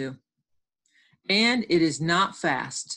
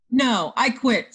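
high, loud, slow refusal; emphatic storybook outburst; angry refusal; 6 semitones higher, 6 dB louder, slower than usual. Acted. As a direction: quit the game in a huff. Acted quarrel in story.

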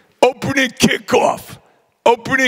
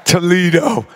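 normal talking voice

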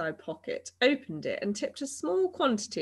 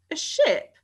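high, louder startled question; taken aback, pitch jumping up; surprised; 10 semitones higher, 6 dB louder than usual. A narrator's reading. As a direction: echo it back in surprise. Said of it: "asked Barnaby in surprise".

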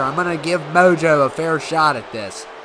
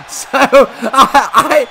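normal talking voice

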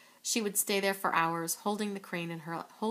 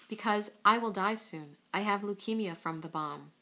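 ordinary delivery

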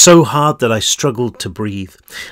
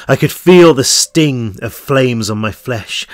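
ordinary delivery, nothing marked

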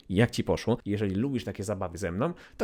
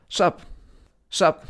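slangy street greeting; 6 semitones higher, 7 dB louder than usual. Shown as a demonstration. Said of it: "To jest oczywiście przywitanie... uliczne, slangowe".

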